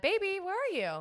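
high, loud call for a partner; retold yell. Camper yelling out of the tent for boyfriend, anxious call. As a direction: holler out into the dark. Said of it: "I basically yell out the tent".

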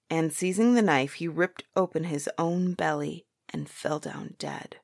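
usual speaking voice

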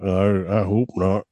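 low creep character voice; creepy doctor voice. Acted. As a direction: answer low, still in the doctor role. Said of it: "Are you gonna talk like that".

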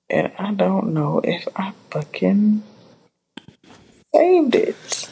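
whispered aside to herself. Real, not acted. Whispering to herself about the lost screenshot. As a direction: drop to a breathy whisper.

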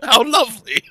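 high, loud, laughing gentleman; super proper. Acted. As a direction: coo it very properly through laughter. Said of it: "super proper in Aquan".

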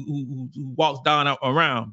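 ordinary talk, nothing marked

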